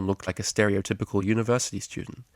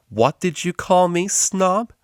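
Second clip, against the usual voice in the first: much higher, loud, slowed challenge; angry; 10 semitones higher, 6 dB louder, slower than usual. Acted. DJ confronts the cellist, angry. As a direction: square up to her. Argument between the two.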